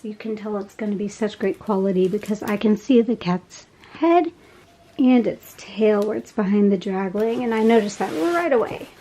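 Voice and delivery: usual speaking voice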